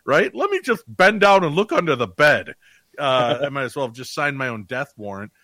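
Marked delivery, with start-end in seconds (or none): 0.0-2.5 loud, high, goofy dimwit voice; hammed-up oblivious fool; horror-movie idiot. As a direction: play the clueless horror-movie victim, big and loud. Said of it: "I'm the idiot in the horror movie".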